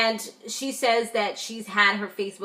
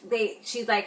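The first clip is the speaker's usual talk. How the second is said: ordinary talk, nothing marked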